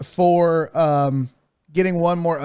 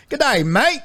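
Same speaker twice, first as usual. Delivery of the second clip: Aussie greeting, voice lifted high; hammed-up Australian accent, cheery; 6 semitones higher than usual. Host reads fan's line in Australian accent. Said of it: "Steelers Nation Australia said".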